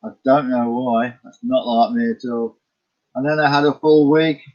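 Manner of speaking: ordinary talk, nothing marked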